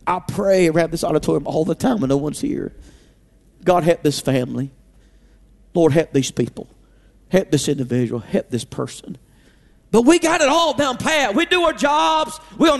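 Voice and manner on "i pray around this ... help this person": quiet prayer. Pretend.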